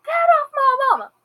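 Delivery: an imitated boy screaming in panic. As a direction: scream for her to get off mom. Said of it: "Noah screaming".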